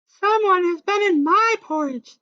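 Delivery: high, prim motherly complaint; high prim mother bear; Mama Bear indignant. A narrator's reading. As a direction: sound shocked like mother bear. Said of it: "said Mama Bear".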